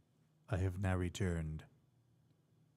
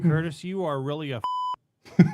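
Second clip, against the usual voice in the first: mock insult; 6 semitones higher, 8 dB louder than usual. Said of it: "shoot expletives into the microphone".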